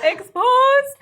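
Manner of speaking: high, loud whoop; gleeful teasing shout. Real, not acted. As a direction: call out the secret in delight. Teasing child caught driving parents' car.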